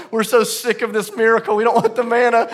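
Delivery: high, loud whine of protest; mock complaining. Israelites grumble to God about manna, mock complaining. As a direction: gripe like a fed-up crowd.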